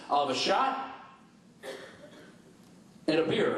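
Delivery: "shot" rises and hangs before "and a beer"; bear's order split by a long silence after "shot"; long pause. Acted. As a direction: stop dead after "shot", then complete the order. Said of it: "What's with the long pause?".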